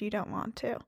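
dropped to a near-silent, low finish; dramatic hush. Pretend. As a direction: deliver it hushed like a grave verdict. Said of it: "I'm gonna be so brave as to state".

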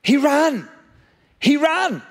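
preacher's mounting "He ran"; building excitement. Real, not acted. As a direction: hammer home the shock, rising each time. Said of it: "Here's the shock".

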